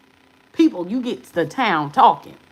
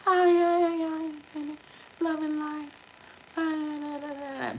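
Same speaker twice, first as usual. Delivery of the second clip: mock sing-song; 6 semitones higher, 8 dB quieter than usual. Mocking generic love and light readers.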